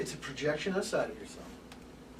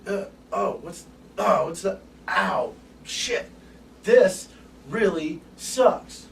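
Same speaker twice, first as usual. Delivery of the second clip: 1 mock dismay; 4 semitones higher, 8 dB louder, much slower than usual. Acting the moment it goes wrong.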